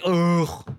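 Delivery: short throaty groan; disgusted ugh. Real, not acted. Fan likening the death to stinky cheese, disgusted groan.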